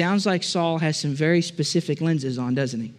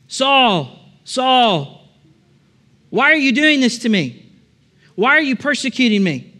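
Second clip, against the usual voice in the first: raised, calling voice from heaven; voice of Jesus; 4 semitones higher than usual. Acted. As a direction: call down to him from the light, pleading. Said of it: "a voice".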